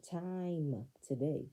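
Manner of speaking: "Time" stretched long and slow; slow, savoring repeat of "Time today"; drawn-out emphasis. Real, not acted. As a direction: linger on "Time" before "today".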